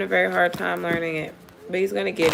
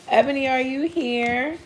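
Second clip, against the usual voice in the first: higher, rising call to someone; 4 semitones higher than usual. Real, not acted. Streamer looking for viewer Ebony, calling out. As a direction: call out for someone, pitched up at the end.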